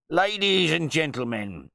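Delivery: loud, high-pitched self-important host; pompous wine bore calling guests to attention; pompous dinner announcement. A narrator's reading. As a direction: proclaim it to the whole table. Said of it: "he would announce at dinner".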